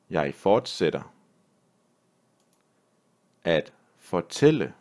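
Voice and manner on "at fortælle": normal talking voice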